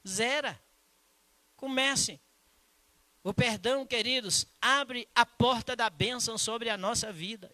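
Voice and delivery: high, loud preacher's call; full-force Portuguese sermon cry; fiery preaching. Acted. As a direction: preach it out to the congregation.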